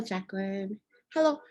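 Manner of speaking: ordinary talk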